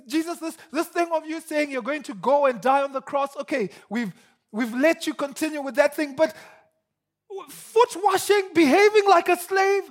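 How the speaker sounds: protest, climbing to disbelief; outraged Peter. Retold or imitated. Peter objecting to his Lord washing feet, outraged.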